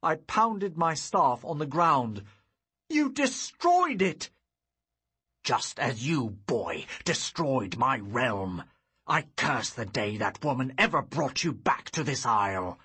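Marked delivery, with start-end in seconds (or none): 2.9-4.3 high, loud, slow roar of fury; furious shout. Acted. Old enemy roaring at the boy over the shattered orb, furious shout. 5.4-12.8 put-on bitter old sorcerer. Sorcerer cursing the boy who ruined his realm, menacing and loud.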